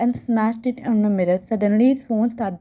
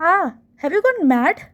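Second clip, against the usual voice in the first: startled yelp; 8 semitones higher than usual. A narrator's reading. Pinched, she cries out.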